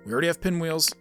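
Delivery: usual speaking voice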